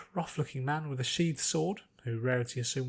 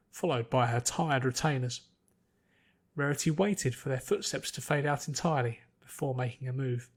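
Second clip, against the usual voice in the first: plain reading voice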